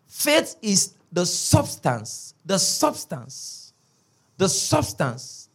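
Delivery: "substance" drawn out slow, every syllable weighted; a preacher planting "substance" in the congregation's ears; stress on substance. Shown as a demonstration. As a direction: repeat "the substance" slowly, hammering it in. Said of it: "I want to emphasize that word substance".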